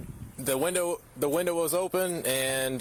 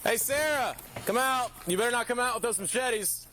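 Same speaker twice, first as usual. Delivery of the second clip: calling out; 7 semitones higher than usual. Real, not acted. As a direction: shout for her to come out of the shed. Officer calling suspect out of shed.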